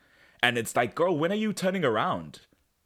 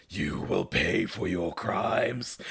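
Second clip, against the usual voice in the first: slow, low, hammed-up villain threat; 6 semitones lower, slower than usual. Host acting Cassie attacking Adam, slow villain threat. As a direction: intone it like a dark sorceress. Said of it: "Cassie's like".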